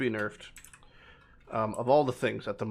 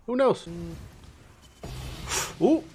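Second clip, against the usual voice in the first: excited; 7 semitones higher than usual. Real, not acted. Hopeful as a map drops.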